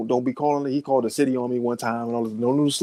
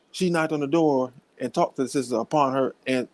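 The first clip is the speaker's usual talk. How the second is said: ordinary talk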